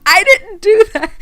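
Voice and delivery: high, loud protest of innocence; mock innocent protest. Speaker protesting innocence to the camera guy, loud. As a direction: hands up, not me. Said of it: "hands in the air like".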